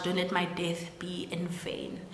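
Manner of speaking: soft whispered voice from beyond; ghostly whisper. Retold or imitated. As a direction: breathe it like a spirit. Dead mother's ghostly voice.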